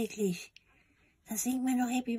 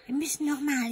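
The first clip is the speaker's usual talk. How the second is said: usual speaking voice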